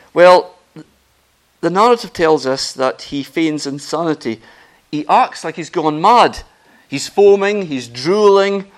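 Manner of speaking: loud, impassioned sermon telling of David's feigned madness; full-force preacher building the story; fervent preaching. Acted. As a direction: preach it out with mounting fire.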